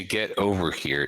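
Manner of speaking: ordinary talk, nothing marked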